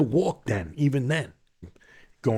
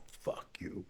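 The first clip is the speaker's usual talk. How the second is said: near-silent whisper; mouthed insult; 22 dB quieter than usual. Retold or imitated. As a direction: mouth it across the courtroom.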